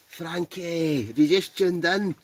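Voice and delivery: high, drawn-out call to a mate; excited greeting. Real, not acted. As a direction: hail a friend who just turned up, pitched high. Calling to friend who just joined.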